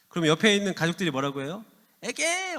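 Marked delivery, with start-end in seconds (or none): 2.0-2.6 a high, dismissive "에게!"; mocking scoff. Family scoffs at the child's boast, high and mocking. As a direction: scoff at the kid's big goal. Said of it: "막 이러면서 무시하죠".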